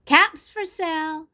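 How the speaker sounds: high, chanted sales cry; peddler's street call. A narrator's reading. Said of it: "he walked back into town calling".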